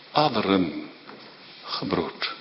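very soft, low and grave; hushed. Acted. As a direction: breathe it out almost silently. Whispered repeat. (zacht en plechtig fluisterend)

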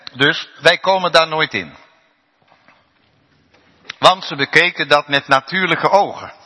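loud, high pulpit preaching; loud rousing sermon; fiery preaching. Acted. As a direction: drive it home to the congregation. Preaching at full force.

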